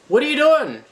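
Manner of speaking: very high, loud horrified cry; crying out in horror. Acted. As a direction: shriek it in sudden horror. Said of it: "he cried out".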